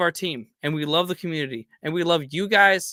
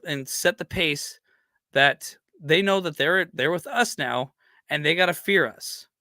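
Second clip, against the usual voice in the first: ordinary talk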